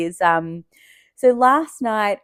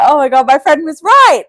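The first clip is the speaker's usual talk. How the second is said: emotional realisation; 7 semitones higher, 9 dB louder than usual. Retold or imitated. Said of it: "I was getting all emotional".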